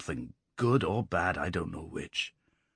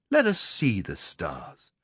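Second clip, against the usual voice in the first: slow, lofty command; slow and haughty, sure of his power; cold, proud; slower than usual. A narrator's reading. Lad about to lift the rock, slow and proud. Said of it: "he said in a cold, proud voice".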